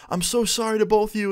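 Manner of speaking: high-pitched mock pleading; imitated tearful apology. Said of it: "she starts crying even harder".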